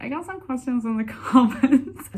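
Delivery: high, loud put-on boast breaking into laughter; mock boast. Vlogger mocking herself about empty comments, laughing mock boast. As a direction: pretend to brag, then crack up laughing. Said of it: "I'm not gonna be like".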